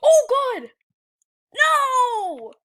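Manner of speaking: high, loud shriek of dismay; real terrified yelp; scared scream. Real, not acted. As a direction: cry out as she grabs you. Caught by the monster.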